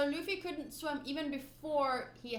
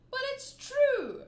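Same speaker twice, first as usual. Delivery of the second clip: high protest; 12 semitones higher, 7 dB louder, slower than usual. Acted. Pirate defending the boy.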